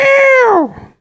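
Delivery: high, piercing imitation meow; loud cat meow. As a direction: yowl like a cat demanding attention. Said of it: "with a very loud meow".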